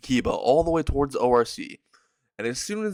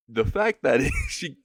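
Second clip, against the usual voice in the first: slow words broken by a real laugh; can't get through it for laughing; 4 semitones higher than usual. Real, not acted. Narrator laughing at the chaos, slowly breaking up.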